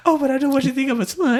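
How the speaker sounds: high, fast mock-worried gush; a hammed-up bashful worry; mock fretting. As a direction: rush it up high, fussy. Voicing Ling fast and high.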